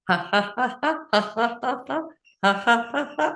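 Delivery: light, deliberate ha-ha laugh; laughing sounds. Shown as a demonstration. Teacher leading crossing-over exercise, practised light laughter. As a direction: laugh in neat repeated beats. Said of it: "just making some laughing sounds".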